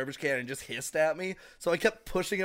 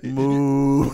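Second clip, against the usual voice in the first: loud mooing call; cow moo; 11 dB louder than usual. Retold or imitated. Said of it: "That's the closest you were today".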